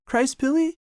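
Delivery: ordinary delivery, nothing marked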